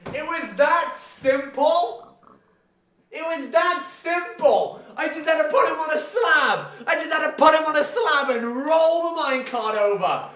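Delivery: high-pitched incredulous wail; high, aggrieved realisation; exasperated disbelief. Real, not acted. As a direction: whine in disbelief at your own oversight. Realises solution was very simple.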